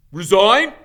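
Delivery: furious team captain yell; thundering. A narrator's reading. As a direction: roar the word back at him. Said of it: "Wood thundered".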